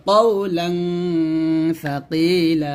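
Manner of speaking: কওলাং held on a nasal hum, then long vowels in সাকিলা; recited ghunnah. Shown as a demonstration. Teacher reciting the example, drawn-out nasal on কওলাং. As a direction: chant it, lingering on the "ng" of কওলাং. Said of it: "লাং বলতে হবে".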